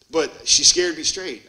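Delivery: ordinary delivery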